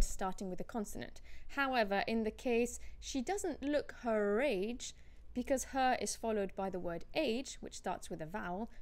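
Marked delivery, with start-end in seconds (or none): 3.0-4.9 slow, with R sounded joining "her age"; deliberate "her-rage" link in "her age". Shown as a demonstration. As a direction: run "her" into "age" through a clear R. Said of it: "you do pronounce the R".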